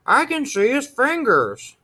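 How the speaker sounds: high little monster voice; scared child pointing out fingers; frightened child. A narrator's reading.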